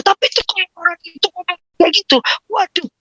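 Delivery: put-on exasperated child. A child explaining to dad why they explode. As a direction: rush it like a flustered kid. Child answers in voiced quote.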